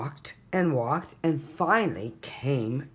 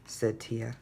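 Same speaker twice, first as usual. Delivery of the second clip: ordinary delivery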